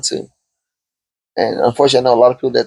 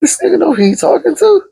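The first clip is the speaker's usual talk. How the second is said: much higher, incredulous inner voice; offended disbelief. Retold or imitated. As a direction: act insulted that he doubts you. Retold thought, offended at the question.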